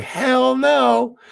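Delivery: high, loud mock mom voice; mom's flat refusal. Mom imagined refusing stunt work, mock high squeal. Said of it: "She's gonna be like".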